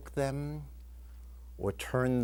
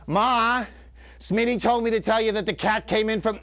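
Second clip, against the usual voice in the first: put-on high, loud kid calling for mom; raised-voice tattletale cry; loud tattling; 8 semitones higher, 6 dB louder than usual. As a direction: blurt it out loudly to tell on a brother. Acted little brother tattling to mother.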